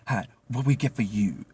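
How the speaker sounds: put-on lightly accented waitress voice; a hint of a foreign waitress greeting; imitating coworker. Coworker greets the table, light put-on accent. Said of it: "she's like".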